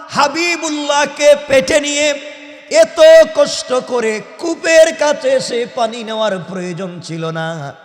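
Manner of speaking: ordinary talk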